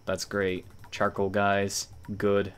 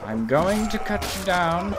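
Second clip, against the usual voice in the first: higher, sing-song rising threat; higher playful threat; mock menace; 9 semitones higher than usual. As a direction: tease it up with a rising end. Repeated threat in battle.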